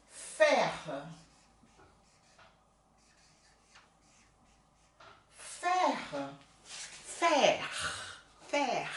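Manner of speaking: faire as open fɛ with a soft final r; teacher drilling faire; French faire. Shown as a demonstration. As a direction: repeat faire slowly, open è. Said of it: "Pra ter uma boa pronúncia".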